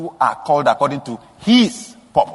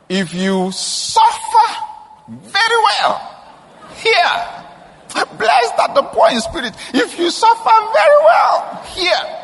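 very high, loud and slow on suffer very well; high shout; 3 dB louder, slower than usual. Real, not acted. Preacher crying out to the congregation, high and loud. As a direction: cry it out high and drawn out.

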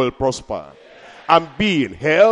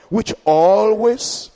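always stretched slowly, rising at the end; drawn-out always; 5 semitones higher, much slower than usual. Shown as a demonstration. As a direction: lead the crowd to repeat always. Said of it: "everybody say".

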